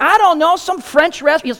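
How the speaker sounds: high, rushed and loud; blurting it out in a panic; flustered. Retold or imitated. Panicked reply to brother.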